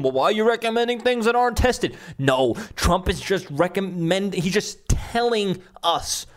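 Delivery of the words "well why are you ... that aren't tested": mocking reporter voice. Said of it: "this guy's trying to stump him".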